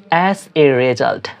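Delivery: slow, clear phrase; teacher pronouncing slowly; slow demo. Shown as a demonstration. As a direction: model it slowly. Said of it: "পরিণাম হিসেবে".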